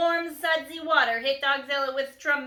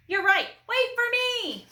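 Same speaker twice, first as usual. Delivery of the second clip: very high, loud squeal; panicked; 12 semitones higher, 5 dB louder than usual. A narrator's reading. Officer runs after his troops, high panicked squeal. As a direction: squeal it and run after them.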